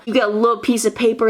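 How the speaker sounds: usual speaking voice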